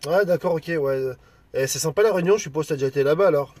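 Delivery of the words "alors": ordinary talk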